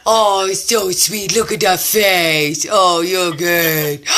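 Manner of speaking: low, slow cooing. Real, not acted. Admiring friend's dog's face, doting baby talk. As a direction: gush slowly over a sweet pet. Coos over a dog on camera.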